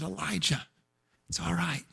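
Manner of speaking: soft, hushed reassurance; still small voice. Acted. God quietly comforting exhausted Elijah, soft voice. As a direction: say it gently, as if to calm a broken man. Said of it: "God spoke in a still small voice".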